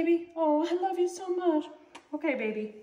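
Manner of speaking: high cooing voice to a pet. Real, not acted. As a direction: dote on the cat. Cuddling her cat.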